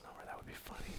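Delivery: whispered, fast aside. Real, not acted. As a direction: whisper it off-mic. Aside away from the mic.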